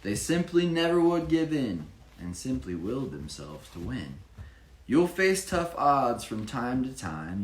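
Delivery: sing-song picture-book narration; rhyming story reading. A narrator's reading. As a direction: read the rhymes to a child with energy. Reads rhyming story aloud.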